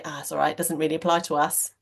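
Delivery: low, quick put-on dodgy accent; lightly mock a dismissive boss in a dodgy non-Welsh accent. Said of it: "they'll say it in that really dodgy type of accent".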